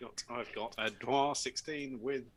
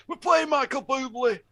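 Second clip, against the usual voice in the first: silly high voice; 13 semitones higher, 9 dB louder than usual. Pretend. Joke announcement, odd voice.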